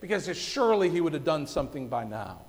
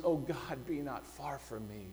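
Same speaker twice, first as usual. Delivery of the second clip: much softer, low, hushed plea; a soft, desperate prayer; desperate pleading; 5 semitones lower, 7 dB quieter than usual. Retold or imitated. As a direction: beg God in a hushed, broken voice. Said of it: "in a desperate pleading voice".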